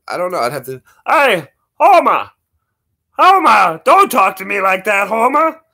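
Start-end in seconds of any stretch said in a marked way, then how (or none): 1.1-5.6 loud, high cartoon scolding; cartoon bartender. Bartender telling Homer off, loud high cartoon voice. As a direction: call out sharply in a put-on voice.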